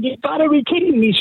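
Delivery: high, excited mock disbelief; retold excited scream. Workmate told he won the lotto, mock screaming. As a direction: squeal it like you just won the lotto.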